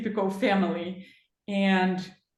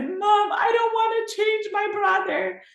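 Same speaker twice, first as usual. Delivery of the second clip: higher, imitating a tearful young boy; crying son; 13 semitones higher, 4 dB louder than usual. Son crying to his mom, imitated higher and tearful. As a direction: echo her little boy's tearful plea, pitched up. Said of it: "he started crying and said".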